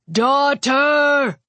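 high, drawn-out commanding call; father calling out. A narrator's reading. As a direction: call to her across a battlefield. Father calls across the battlefield.

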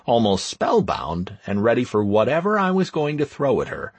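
ordinary delivery, nothing marked

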